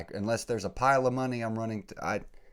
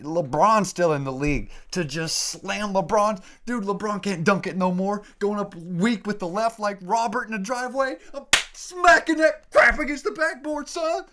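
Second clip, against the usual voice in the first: much higher and louder, near screaming; excited yelling; 12 semitones higher, 10 dB louder than usual. Real, not acted. Podcaster imagining posterizing LeBron, screaming with hype. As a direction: yell it like you just dunked on LeBron.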